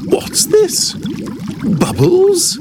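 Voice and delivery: very high, squeaky wonder; puzzled surprise. A narrator's reading. Hippo finds bubbles coming out, high surprised voice. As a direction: ask in a tiny, high-pitched voice.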